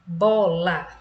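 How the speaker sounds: "bola" with BO stronger and "la" falling away; stress on first syllable. Shown as a demonstration. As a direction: lean on "bo" in "bola". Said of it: "Quem é a sílaba tônica".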